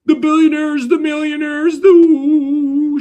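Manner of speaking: high, loud, hammed-up sympathy for the rich; sarcastic high voice, mocking fake concern for the rich; mocking pity. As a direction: whine it out in mock pity for the billionaires. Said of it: "be like".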